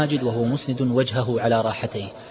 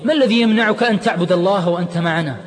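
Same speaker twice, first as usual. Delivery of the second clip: pleading call, higher and louder; urgent cry; 8 semitones higher, 6 dB louder than usual. Acted. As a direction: grab his hand and cry it out. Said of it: "هتف عماد وهو يمسكه من يده".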